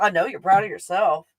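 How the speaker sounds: ordinary talk, nothing marked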